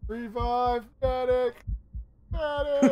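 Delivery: shouting for a medic, high; urgent yell for help; man down shout. Real, not acted. Downed teammate, calling for medic.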